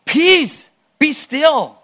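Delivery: short, separate words of command. Acted. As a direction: order the waves to settle with authority. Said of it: "and Jesus commands".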